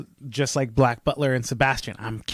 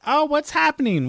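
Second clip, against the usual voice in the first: much higher mock-curious voice; imitating nosy girlfriend. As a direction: pipe up high like a pestering partner. Mimics girlfriend asking about show.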